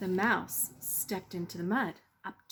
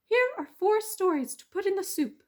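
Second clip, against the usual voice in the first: high, loud persuasive mouse; a small mouse talking his way out; clever mouse voice; 13 semitones higher, 7 dB louder than usual. Acted. Mouse persuading the weasel, high and eager.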